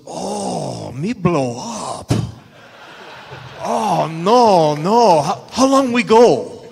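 hammed-up panicked Japanese accent, half laughing; broken English in a put-on Japanese accent; Japanese accent imitation.

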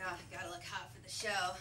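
ordinary talk, nothing marked

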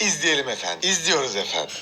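low, smooth anchor tone; TV announcer voice. Shown as a demonstration. Playing a TV anchor for his viewers. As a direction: roll it out like a broadcaster. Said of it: "televizyon spikerlerinin kullandığı bir laf".

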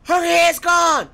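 loud high horrified yelling; high strained yells of horror; horrified shout. Real, not acted. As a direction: shout out in shock and disgust. Horrified at mutated enemy.

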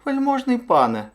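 very high little hare voice; high-pitched meek hare; deferential hare. A narrator's reading. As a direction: address a lord timidly, high up. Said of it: "мовив заєць".

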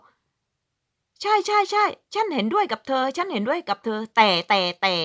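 high, slow put-on "ใช่ ใช่ ใช่" then "แต่ แต่ แต่"; mocking agreement. Mimicking a Finn who agrees then says but, high and slow. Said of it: "เขาบอก".

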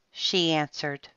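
usual speaking voice

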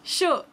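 chaud said like show, high and falling; French chaud. Shown as a demonstration. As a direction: say chaud together with them. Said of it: "Say it with me".

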